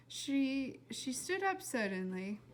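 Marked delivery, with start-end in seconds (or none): none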